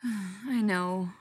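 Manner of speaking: a sighing, rueful admission; sighed. A narrator's reading. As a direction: let it out on a sigh. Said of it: "Spencer sighed".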